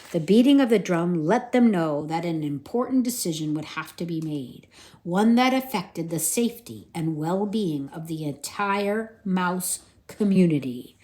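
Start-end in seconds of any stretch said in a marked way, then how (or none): none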